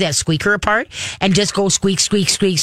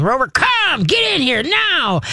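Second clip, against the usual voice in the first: mock yell; 9 semitones higher, slower than usual. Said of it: "start screaming".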